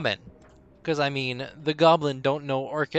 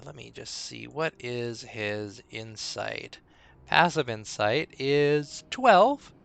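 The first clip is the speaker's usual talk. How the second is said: usual speaking voice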